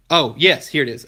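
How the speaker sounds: loud, bright oh yes; sudden recall. Real, not acted. To viewers, recalling his lost point, a loud sudden oh yes. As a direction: burst out as it comes back.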